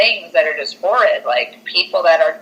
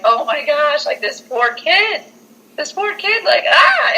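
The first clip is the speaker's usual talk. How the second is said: high-pitched pitying squeal rising to a little shriek; mock distress; 9 semitones higher than usual. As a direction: play the frightened, pitying viewer. Acts out distressed viewer watching.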